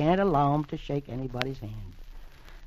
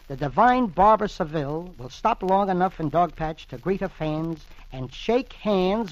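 headline announcement read; 4 semitones higher, 4 dB louder than usual. Acted. Reads newspaper headline aloud.

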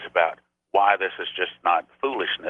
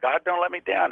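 high, pleading cry to God; desperate cry; 8 semitones higher than usual. Retold or imitated. Pastor on the electric chair cries out to God, high and desperate. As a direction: beg God not to fail you. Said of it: "So I cried out".

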